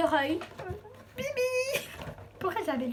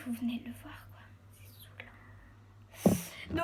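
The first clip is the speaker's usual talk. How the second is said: whisper; 2 semitones lower, 6 dB quieter, slower than usual. Real, not acted. Annoyed aside to viewers.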